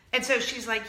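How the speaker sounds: ordinary talk, nothing marked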